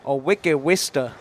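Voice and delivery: Boston accent, wicked dropped-r; slow, lazy Boston drawl. Shown as a demonstration. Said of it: "I've been practicing my Boston accent".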